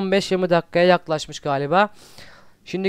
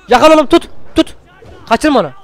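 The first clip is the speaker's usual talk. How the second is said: much higher, loud frantic yell; frantic shout; 7 semitones higher, 7 dB louder than usual. Real, not acted. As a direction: scream the order at the wolf. Kurduna bağırıyor. (çılgınca bağırarak komut)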